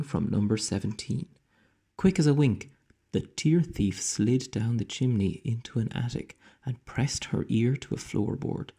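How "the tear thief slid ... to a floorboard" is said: ordinary delivery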